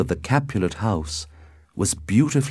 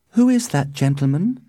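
higher, curious question; Juliet's higher voice, inquiring; young lady asking; 8 semitones higher, 3 dB louder than usual. A narrator's reading. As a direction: ask in a raised, girlish pitch. Juliet asks her nurse.

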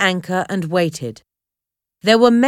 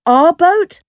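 high, loud startled cry; alarmed cry; 9 semitones higher, 7 dB louder than usual. A narrator's reading. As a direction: cry it out in shock. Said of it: "Harvey cried".

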